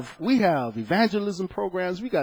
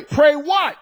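rhetorical call; 9 semitones higher, 5 dB louder than usual. Real, not acted. Preacher challenges congregation.

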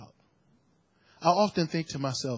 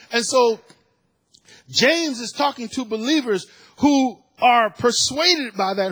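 raised, ringing preacher's cry, higher and louder; fiery preaching; 9 semitones higher, 5 dB louder than usual. Real, not acted. Pastor crying out that the world worships money, fiery. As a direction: preach it out, building to full force.

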